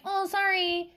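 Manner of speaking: high, soft put-on apology; simpering mock sorry. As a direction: imitate an apologetic parent, high and small. Mimics apologetic parent.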